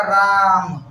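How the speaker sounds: राम with the आ stretched long; drawn-out pluta vowel. Shown as a demonstration. Teacher stretching राम to show a pluta vowel. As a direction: hold the आ in राम for three beats. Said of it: "इसमें जितना खींच देंगे इसको".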